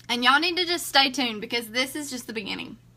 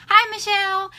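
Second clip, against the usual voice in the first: greeting; 8 semitones higher, 6 dB louder than usual. Real, not acted. Greets someone arriving.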